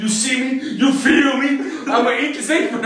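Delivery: high, loud wrestler hype chant; hammed-up hype promo, laughing at the end; Big E hype. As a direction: pump up the crowd like a hyped wrestler. Mimicking Big E's hype promo.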